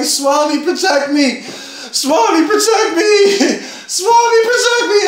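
frantic screaming plea. Retold or imitated. Running through the graveyard calling on Swami, panicked screaming. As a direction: beg Swami at the top of your lungs, panicking. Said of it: "I just start screaming".

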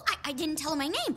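ordinary delivery

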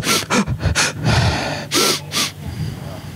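breathy, voiceless gasps and sniffs; shocked, wordless breaths drawn out slowly; imitated gasps. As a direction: gasp as a stunned audience would. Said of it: "All you could hear in that theater was".

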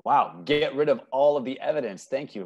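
usual speaking voice

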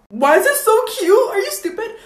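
high, loud delighted gush; high excited gush. Real, not acted. Vlogger gushes to viewers about her new piercing. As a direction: gush over it like a new toy.

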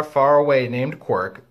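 plain reading voice

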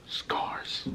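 breathy unvoiced whisper of Scars. Echoed word, whispered.

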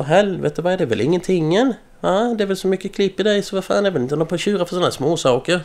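boastful retold rant at a sulking classmate; mock telling-off. Retold scolding of Marcus.

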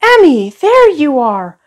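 put-on boy's relieved shout; relieved call. A narrator's reading. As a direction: call out happily at finding her. Ben finds his lost sister.

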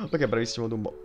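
ordinary talk

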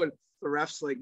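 usual speaking voice

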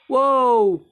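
high, loud drawn-out wow; startled shout. Real, not acted. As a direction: shout in sudden fright. Jump scare in horror game. (gulat na pasigaw)

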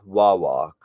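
Wawak said "WAW-walk", second syllable like walk; correct pronunciation. Shown as a demonstration. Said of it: "how they pronounce their name".

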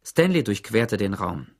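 plain reading voice

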